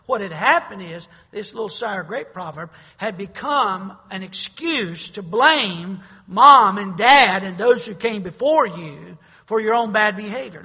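loud, building sermon delivery, slowing at the end; fiery preaching. Acted. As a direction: preach it hard to the congregation. Preaching at full force.

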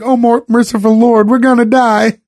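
put-on high, panicked old man; Jimmy Carter. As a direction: squeal out a frightened prayer. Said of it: "Jimmy Carter going like".

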